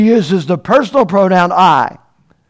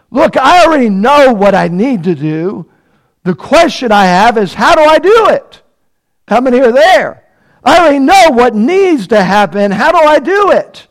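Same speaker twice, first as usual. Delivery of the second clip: pleading believer; 5 semitones higher, 4 dB louder than usual. Pretend. Voicing a worried believer's question.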